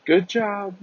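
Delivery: high-pitched cheer from afar; imitated lady calling. Lady at the roadside to the group, imitated high call.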